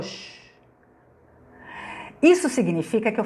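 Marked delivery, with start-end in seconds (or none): 0.0-0.3 soft hushing hiss; imitated shush. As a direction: pass on a daughter's quiet shush. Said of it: "Minha filha falou". (chiado de silêncio imitado)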